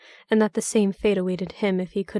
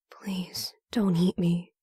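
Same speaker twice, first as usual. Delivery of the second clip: barely voiced whisper, drained of strength; faint whisper; 3 dB quieter, much slower than usual. A narrator's reading. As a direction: breathe the plea out with no voice left. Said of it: "Frank managed as he succumbed to dehydration".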